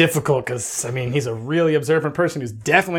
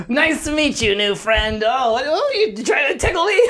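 put-on high, giggly drunk halfling; giddy halfling voice; 10 semitones higher than usual. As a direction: squeal it out playfully. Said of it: "he's like".

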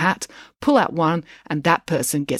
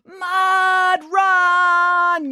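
high, louder, stretched chant; crowd yell; 13 semitones higher, 4 dB louder than usual. A narrator's reading. Crowd answering Brad's urging, yelling. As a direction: yell it as one excited crowd. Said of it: "yelled the crowd".

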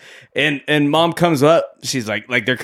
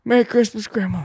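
put-on higher, sing-song little girl greeting; lightly voiced little girl; sweet little girl; 9 semitones higher than usual. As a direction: play the eager granddaughter. Trudy greeting grandma again.

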